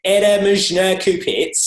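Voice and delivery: Russian butchered in a high, loud Australian accent; slow, exaggerated Australian-accented Russian; awful Australian accent. Shown as a demonstration. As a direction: blare the Russian in a broad Aussie accent. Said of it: "my awful Australian accent comes out".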